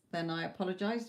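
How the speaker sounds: ordinary delivery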